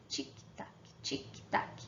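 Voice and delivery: sussurre "tic-tac" imitando um relógio; tique-taque baixinho de relógio; tique-taque sussurrado. Shown as a demonstration. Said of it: "representando o som do relógio". (imitação sussurrada do relógio)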